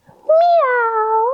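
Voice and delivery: high mewing meow; a high little kitten meow; cat meow. Shown as a demonstration. Teacher leads kids in a playful high cat meow. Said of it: "say meow".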